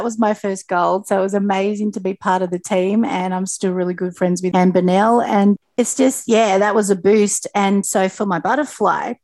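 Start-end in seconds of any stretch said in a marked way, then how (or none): none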